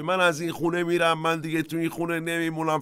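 put-on high whiny kid threatening to leave; childish threat. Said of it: "مثل بچهٔ کوچیکی".

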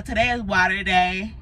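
ordinary talk

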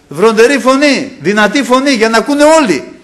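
high, loud preaching cry. Shown as a demonstration. Preacher shows how the Lord preached without microphones, thundering. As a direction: demonstrate a voice that carries to all. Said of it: "Υψώναν τη φωνή τους".

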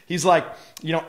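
usual speaking voice

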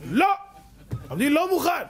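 stubborn refusal. Retold or imitated. Father rejects the rabbi's ruling, shouting stubbornly. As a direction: dig in and refuse.

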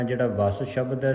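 normal talking voice

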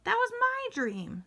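high-pitched surprised exclamation; amazed at the coincidence; astonished. A narrator's reading. Jack amazed at shared dream.

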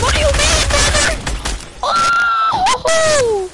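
very high, loud cartoon squeak; squeaky high voice. Goofy squeaky voice mid-game.

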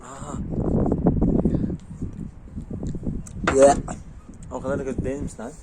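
talking through burning-hot food; mouth full of hot food. Real, not acted. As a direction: speak while a hot bite burns the mouth. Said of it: "Ağzım yandı ama".

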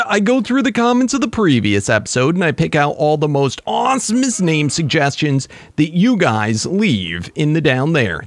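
normal talking voice

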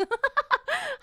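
high, helpless laugh; burst of laughter. Real, not acted. Streamer cracking up at her own joke, high laughter.

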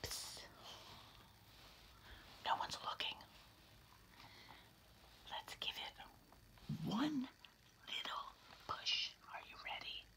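soft, sneaky whisper; conspiratorial whisper. Acted. Larry urging the reader to push, whispering. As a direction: coax them quietly into mischief.

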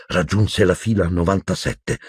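ordinary delivery

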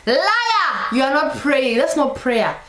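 high, sudden mock-indignant cry; accusing outburst. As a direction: cut them off with a high accusation. Cuts off the mocked believer.

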